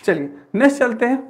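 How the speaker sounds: ordinary talk, nothing marked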